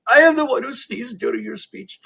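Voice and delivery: high, scared comic voice; frightened confession. Acted. Terrified man at the back confesses to Stalin, high frightened voice. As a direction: confess in terror to a dictator. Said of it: "this guy waves his shaking hand".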